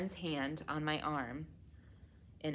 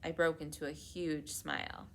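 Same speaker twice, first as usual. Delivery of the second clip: ordinary delivery, nothing marked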